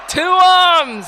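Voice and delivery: high, loud shouted order; battle command. Acted. Chieftain shouting her troops into the attack. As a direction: bark the call to battle.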